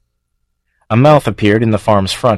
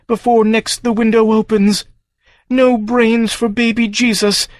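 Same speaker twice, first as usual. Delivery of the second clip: lamenting farm creature; 12 semitones higher than usual. Acted. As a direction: grieve your exile, then turn on him. Farm's long lament.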